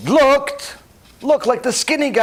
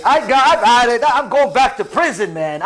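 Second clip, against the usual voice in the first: fed-up anger; 5 semitones higher, 4 dB louder than usual. Acted. Big guy loses his temper.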